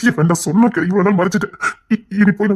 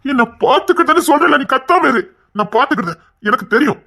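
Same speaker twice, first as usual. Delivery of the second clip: higher-pitched, impatient order; irritated scolding; 6 semitones higher than usual. Acted. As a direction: shut down the complaint impatiently. Husband orders her to stop wailing.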